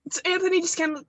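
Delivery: ordinary talk, nothing marked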